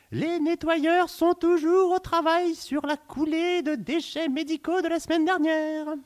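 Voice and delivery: very high, loud chipmunk weather host; squeaky cartoon voice. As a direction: chirp the forecast like a cartoon. Weather report, squeaky character. (présentatrice météo suraiguë de dessin animé)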